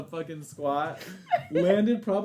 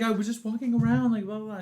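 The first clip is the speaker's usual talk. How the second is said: higher-pitched kid playing innocent; mock innocent excuse; 6 semitones higher than usual. Kid making excuses to mom about the arm, mock innocence. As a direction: plead innocence in a raised, singsong pitch.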